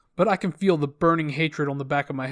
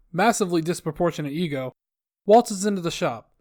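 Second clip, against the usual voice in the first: ordinary delivery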